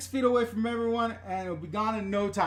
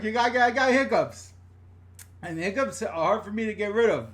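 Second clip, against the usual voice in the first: normal talking voice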